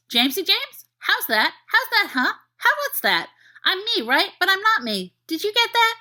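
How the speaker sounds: high, anxious puppy questions; confused puppy. Acted. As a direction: whine like a puzzled dog. Dog Sirius confused.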